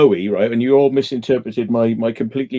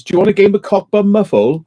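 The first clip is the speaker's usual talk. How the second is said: quoting a comedy character; 6 semitones higher, 4 dB louder than usual. Retold or imitated. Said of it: "He goes".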